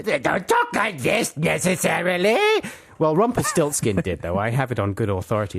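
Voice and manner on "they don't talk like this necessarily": high, put-on gnomey accent; caricatured little gnome, pitched up. Shown as a demonstration. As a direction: demonstrate a silly high gnome voice. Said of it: "That's exactly how he sounded".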